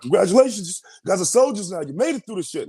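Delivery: high, imitated rousing congratulations; hyping up. Officer to newly graduated soldiers, hyping them up. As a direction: hype up the new soldiers. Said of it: "hyping us up".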